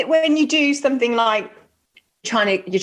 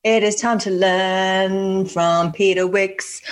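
announcer; 4 semitones lower, slower than usual. Pretend. Announcer segment intro.

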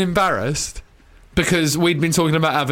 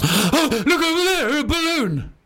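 put-on high, gasping little kid; mocking Joel as a distracted toddler; childlike; 12 semitones higher than usual. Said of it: "like a child".

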